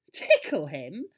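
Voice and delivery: loud, astonished echo with a rising end; amazed, swooping question; incredulous question. A narrator's reading. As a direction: repeat it in utter disbelief. Boy astonished at the doctor's answer.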